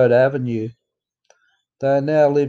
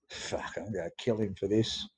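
much softer, murmured under the breath; inner thought; 5 semitones lower, 13 dB quieter than usual. Retold or imitated. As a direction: say it to yourself, not to Dad.

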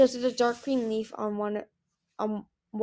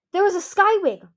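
high, loud, angry protest; snarled; 10 semitones higher, 9 dB louder than usual. A narrator's reading. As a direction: snarl it in outrage. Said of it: "Winter snarled".

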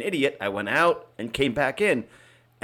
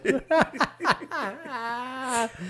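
a high laugh that tumbles down; a high, falling laugh; 10 semitones higher than usual. Real, not acted. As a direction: laugh at your own joke. Laughs after own joke.

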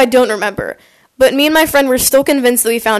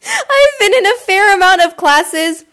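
real laughter through the words, higher pitched; laughing; 8 semitones higher, 3 dB louder than usual. Real, not acted. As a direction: talk while cracking up. Bursts out laughing at the memory.

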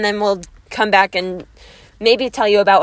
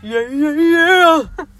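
high, loud, drawn-out meme yell; hammed-up meme wail, stretched right out; James Charles meme; 7 semitones higher, 7 dB louder, much slower than usual. Friend quoting a meme to her co-host, exaggerated drawn-out wail. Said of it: "that James Charles meme".

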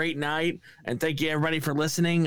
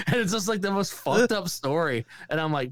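normal talking voice

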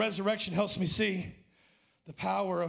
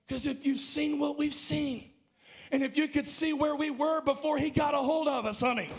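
full preaching shout; 6 semitones higher, 3 dB louder than usual. Acted. Preacher shouting, slowed peak.

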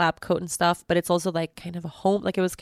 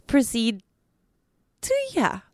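embarrassed trailing off; 2 semitones higher than usual. Real, not acted. Can't say what the vet did.

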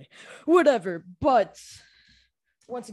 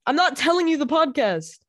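annoyed; 8 semitones higher, 5 dB louder than usual. Real, not acted. Arguing with brother at door.

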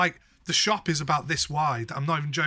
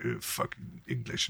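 sneering Parisian; 10 dB quieter than usual. Retold or imitated. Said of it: "I thought they'd be like".

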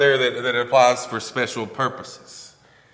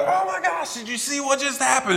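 high-pitched excited bystander; high, gushing retold excitement; mock excitement; 11 semitones higher than usual. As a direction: imitate an amazed townsperson in a high voice. Voicing excited townspeople spreading news.

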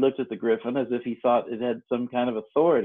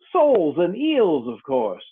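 impatient; 6 semitones higher, 5 dB louder, slower than usual. A narrator's reading. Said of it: "replied rather impatiently".

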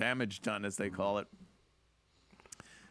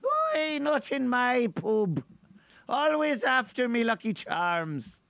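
higher, louder hammed-up mock Irish leprechaun; 11 semitones higher, 7 dB louder, slower than usual. Host riffing as an Irish leprechaun, loud and high. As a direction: shout it like a cartoon Irish leprechaun, Irish accent.

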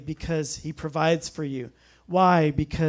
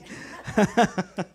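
high-pitched chuckle after own re-enactment; laugh; 5 semitones higher than usual. Real, not acted. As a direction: laugh at your own awkwardness.

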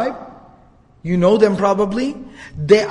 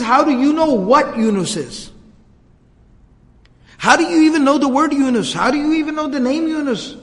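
shocked disbelief; 7 semitones higher than usual. Retold or imitated. Addas stunned that the Prophet knows Yunus, shocked. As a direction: blurt it out in amazement. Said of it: "he's so shocked".

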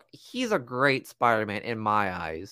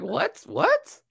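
high, rising disbelieving what, climbing higher; baffled what. Retold or imitated. Andrew hearing Toby's webs, high baffled squeak. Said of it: "Andrew was like".

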